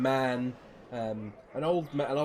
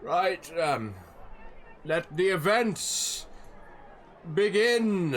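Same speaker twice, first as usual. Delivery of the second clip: raised, drawn-out proclamation; event announcer; 5 semitones higher, slower than usual. Acted. Announcer starts the show for the crowd, proclaiming voice. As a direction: open the show, building to the last word. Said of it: "And you see, like".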